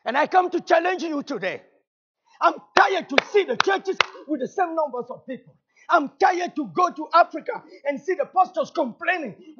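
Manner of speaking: high, loud, impassioned challenge; full-force preaching. Real, not acted. Preacher challenging the congregation about tired churches, full-force preaching.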